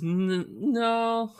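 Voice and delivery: high, stuttered put-on denial; imitated wife. Wife denying it to husband, high coy imitation.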